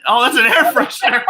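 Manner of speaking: high burst of laughter; laughing. Real, not acted. Watching a friend's video, laughing hard. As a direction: crack up at the air freshener.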